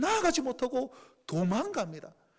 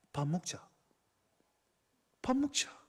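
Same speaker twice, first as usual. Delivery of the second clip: very soft, low, gentle "밥 먹자"; tender hushed invitation; 7 semitones lower, 9 dB quieter than usual. Acted. Jesus gently inviting Peter to eat, hushed and low. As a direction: quietly offer a meal to the ashamed. Jesus speaks after silence.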